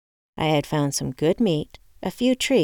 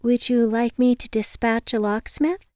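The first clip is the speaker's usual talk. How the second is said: higher, measured receptionist voice; patient receptionist; 7 semitones higher than usual. A narrator's reading. Receptionist offers to dispatch a locksmith, patient. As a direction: ask with polite patience, pitched up. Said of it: "she asked patiently".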